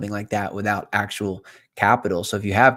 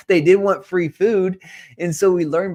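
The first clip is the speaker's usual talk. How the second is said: laughing; 8 semitones higher, 6 dB louder than usual. Real, not acted. Laughing about the house party.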